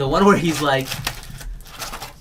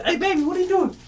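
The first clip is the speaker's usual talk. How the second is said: high voice; 14 semitones higher, faster than usual. Retold or imitated. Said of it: "He says".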